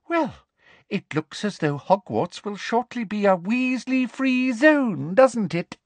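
high, sugary smugness; mock the Weasleys prettily; high smug gloat; gloating sweetness. Umbridge gloating.

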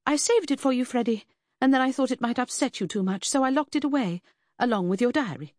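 high-pitched, flustered explanation; blustering. A narrator's reading. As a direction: keep blustering through the excuse, high and insistent. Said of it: "Lucy blustered".

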